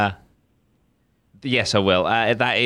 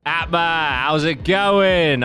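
upbeat host ad intro; bright promotional greeting, slow on the second phrase; ad read; 8 semitones higher, much slower than usual. Acted. Sponsor ad read opening.

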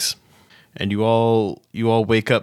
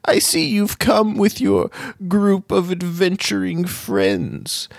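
high, slow, ominous gnome wizard warning; old gnome wizard; 9 semitones higher, slower than usual. Acted. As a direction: warn of the curse like a creaky old sage.

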